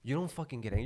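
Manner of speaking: ordinary talk, nothing marked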